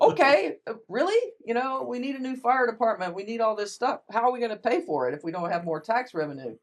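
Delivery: high, loud, disbelieving; incredulous. Retold or imitated. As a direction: sound amazed anyone says that. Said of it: "I'm like".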